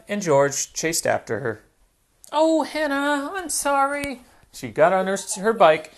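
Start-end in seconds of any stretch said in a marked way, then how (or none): 2.3-4.2 high, pleading little piglet; apologetic Peppa. Acted. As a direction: beg forgiveness, high and quick.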